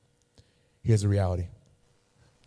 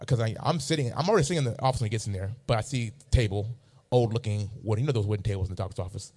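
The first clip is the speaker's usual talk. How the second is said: ordinary delivery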